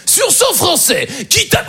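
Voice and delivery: very high, drawn-out game-show host voice; Jean-Luc Reichmann imitation. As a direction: do an over-the-top TV presenter impression. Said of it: "bien faire Jean-Luc Reichmann".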